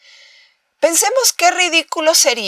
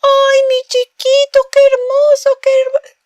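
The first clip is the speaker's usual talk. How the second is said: over-the-top parentese, pitched way up; high-pitched baby talk; 14 semitones higher, 5 dB louder than usual. Shown as a demonstration. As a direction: coo at a newborn in a squeaky high voice. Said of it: "utilizan un tono muy agudo".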